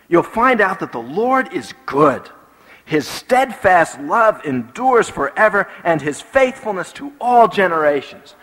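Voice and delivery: fervent preacher's declamation; preached scripture. Acted. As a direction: declare the psalm with drawn-out key words.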